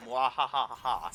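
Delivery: slow, loud villainous cackle; evil laugh. Pretend. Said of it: "Please enjoy my evil laugh".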